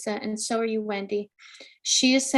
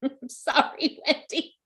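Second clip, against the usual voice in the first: usual speaking voice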